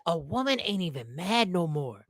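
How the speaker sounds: ordinary delivery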